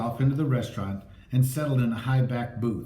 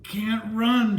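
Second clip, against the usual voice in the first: gasping; 8 semitones higher, much slower than usual. A narrator's reading. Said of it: "she gasped".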